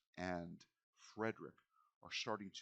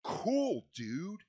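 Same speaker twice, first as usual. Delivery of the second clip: loud, high surfer-dude cheer; excited; 12 semitones higher, 12 dB louder, slower than usual. Retold or imitated. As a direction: gush like a thrilled teen. GM voices excited players.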